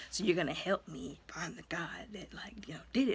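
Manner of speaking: soft whispered instruction; hushed order. Retold or imitated. Father recruiting his son for revenge, whispered. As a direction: murmur the plan to the boy.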